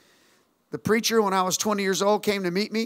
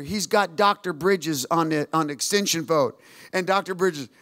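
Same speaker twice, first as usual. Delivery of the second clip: ordinary talk, nothing marked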